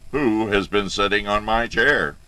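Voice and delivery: loud, big bear outcry; great big bear voice. A narrator's reading. Big bear finds his chair used, great big voice. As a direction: demand it as a huge, cross bear. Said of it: "he cried out in a great big voice".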